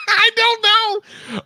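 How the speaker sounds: high laughing I don't know, drawn out slowly. Real, not acted. Laughing to co-host at a mystery beep, stretched out high. Laughing bewilderment over recorder.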